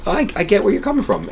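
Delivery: put-on high-pitched old lady voice, conceding; imitating an older lady agreeing. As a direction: lift into her voice as she comes around. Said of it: "she was like".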